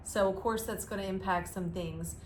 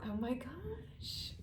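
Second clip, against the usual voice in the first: slow breathy whisper of delight; 5 semitones higher, 9 dB quieter, much slower than usual. Real, not acted. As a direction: whisper it in awe. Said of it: "whispered delight".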